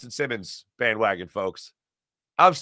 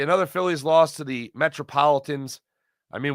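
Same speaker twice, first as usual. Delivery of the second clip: ordinary talk, nothing marked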